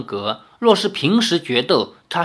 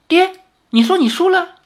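much higher, louder, beaming; delighted question; 5 dB louder than usual. Acted. Lin Yueru delighted that her father admits defeat, much higher and beaming. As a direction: bubble over with glee. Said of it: "高兴地说".

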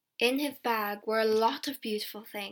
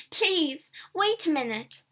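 higher-pitched plea, called after someone; calling out; 6 semitones higher than usual. A narrator's reading. Younger child to older sister walking ahead, calling out. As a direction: call out pleadingly in a raised pitch. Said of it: "I called to my sister".

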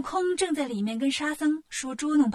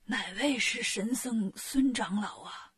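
slow, slightly lowered sick-king voice; ailing king; 3 semitones lower, 4 dB quieter, slower than usual. Acted. Sick king asks the group who the divine monk is, feebly. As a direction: ask feebly, drawing out each phrase.

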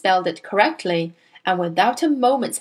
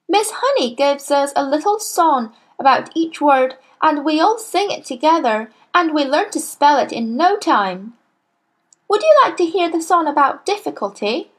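put-on higher keen boyish telling; eager boy; 7 semitones higher than usual. A narrator's reading. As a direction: proudly explain the song. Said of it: "said Nigel".

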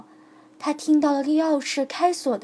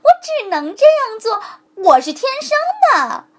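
high, laughing reply; 10 semitones higher, 9 dB louder than usual. A narrator's reading. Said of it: "狐狸哈哈大笑". (狐狸边笑边高声回答)

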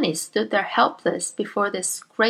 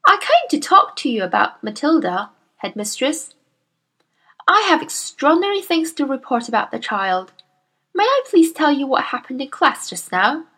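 put-on higher, earnest young teacher voice; Miss Honey; 6 semitones higher, 3 dB louder than usual. Young teacher protests to her headmistress, earnest and higher. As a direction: politely protest to a bully.

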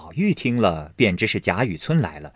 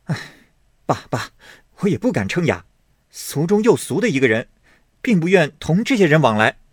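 rising, quicker protest; young master refusing indignantly; 5 semitones higher, faster than usual. Acted. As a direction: push the idea away. Baoyu protests.

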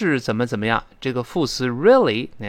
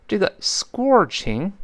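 ordinary talk

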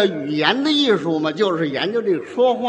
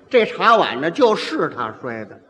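usual speaking voice

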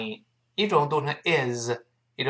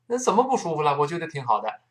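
ordinary talk, nothing marked

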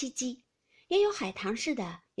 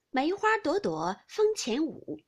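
slow, lifted, louder verse line; verse recitation; 6 semitones higher, 5 dB louder, slower than usual. A narrator's reading. Aunt answering the maid with a rhyme, slow lilting recitation. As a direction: recite it measured, with raised voice. Capping verse in drinking game.

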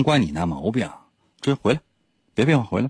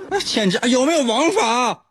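laughing; 12 semitones higher than usual. Real, not acted. Mock outrage while laughing.

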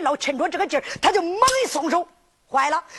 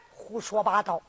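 stern teacher; 9 semitones lower, 6 dB quieter than usual. Acted. Teacher rebukes the quarrelling child.

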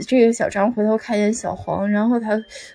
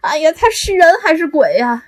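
high, loud frightened exclaim; startled cry; 6 semitones higher, 5 dB louder than usual. Retold or imitated. Student who screamed asks if he's human, frightened shout. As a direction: cry out as if seeing a ghost.

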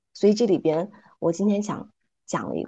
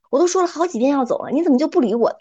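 raised, annoyed mosquito shouting; a fed-up mosquito yelling, higher; 生气嚷嚷; 7 semitones higher, 4 dB louder than usual. A narrator's reading. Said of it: "就开始对牛大声嚷嚷说". (蚊子生气地冲牛嚷嚷)

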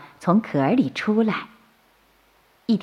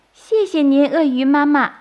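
put-on high childlike thanks; baby turtle voice; 8 semitones higher, 3 dB louder than usual. A narrator's reading. Baby turtles thank crocodile mother, high and childlike. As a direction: thank her like a small child.

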